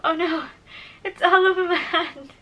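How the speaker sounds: laughing. Real, not acted. Product smeared on hand.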